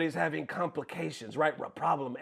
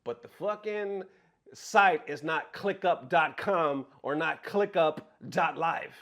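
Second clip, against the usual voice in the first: normal talking voice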